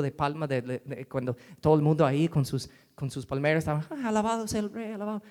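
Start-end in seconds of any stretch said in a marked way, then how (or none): 3.9-5.2 higher, cheering crowd; crowd praise. Acted. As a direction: hail the king like the crowd.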